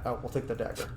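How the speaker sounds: ordinary delivery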